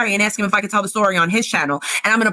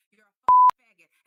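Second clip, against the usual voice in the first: furious insult; 4 dB louder than usual. Real, not acted. Ranting streamer hurling insult at Nick on stream. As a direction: pitch leaps high on the slur, louder.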